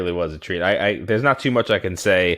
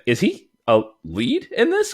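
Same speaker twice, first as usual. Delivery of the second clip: surprised; 11 semitones higher than usual. Retold or imitated. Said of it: "And I was like".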